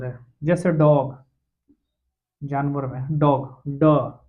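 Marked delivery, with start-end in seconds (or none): 3.8-4.2 ड struck alone, a little louder; isolated sound demo. Shown as a demonstration. Teacher isolating ड for students. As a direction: sound out ड as a single syllable. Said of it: "ऐसे उच्चारण होगा".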